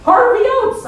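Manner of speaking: high, slow mock-literary gush; hammed-up high pretend reverence for authors; mock bookish voice. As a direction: gush about books like a snob. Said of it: "They're like".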